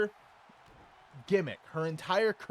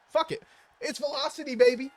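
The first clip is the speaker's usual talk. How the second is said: high, loud hype shout; excited; 11 semitones higher, 5 dB louder than usual. Real, not acted. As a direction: hype it up like a promo. Hyped booking announcement.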